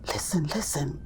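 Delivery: put-on breathy stage whisper to the kids; a sudden hushed gasp to draw the children in. Teacher whispering to toddlers to listen.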